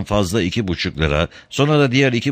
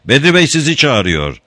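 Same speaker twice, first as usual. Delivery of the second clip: calling out; 8 semitones higher, 5 dB louder than usual. A narrator's reading. Calling to the woman.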